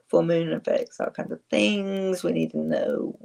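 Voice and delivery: ordinary talk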